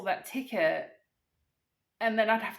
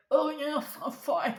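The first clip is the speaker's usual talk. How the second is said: higher, choked-up imitation of tearful reassurance; mock choking on tears; 6 semitones higher, 3 dB louder, slower than usual. Crying caller answers agent while choking, mock tearful. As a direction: strangle the words as if choking on snot. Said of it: "Choking on my own snot 'cause I'm crying".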